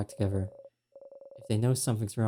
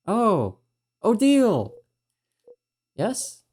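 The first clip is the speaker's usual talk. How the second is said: much higher, louder burst of recognition; startled exclaim; 11 semitones higher, 8 dB louder, much slower than usual. Acted. Remembering the maid's name, a loud startled cry. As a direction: shout her name as it flashes back.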